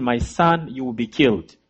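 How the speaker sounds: quiet, heavy retold despair; desperate mother, hushed. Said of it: "She was desperate".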